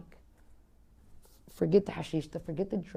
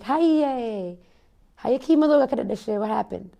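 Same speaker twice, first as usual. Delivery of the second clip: higher, louder, fast fake-cheery mom voice; mocking the mom; 4 semitones higher, 8 dB louder than usual. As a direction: ham up her loud, nosy sweetness. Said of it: "She's like".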